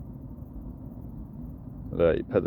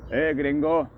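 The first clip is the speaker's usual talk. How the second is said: gringo in a hammed-up Mexican accent, much higher; mock Mexican accent; 9 semitones higher than usual. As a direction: call out gringo again like a jokey Mexican. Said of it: "with a Mexican accent".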